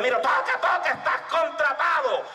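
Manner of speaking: high, stretched calling; excited shout. Acted. Bandleader hires the teller mid-drumroll, high excited shout. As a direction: call it out over the drumming.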